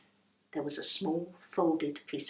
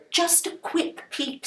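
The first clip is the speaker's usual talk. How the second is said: higher, slow coaxing plea; a touch of pleading; coaxing Rose; 11 semitones higher, slower than usual. Acted. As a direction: talk her round gently. Rose coaxes.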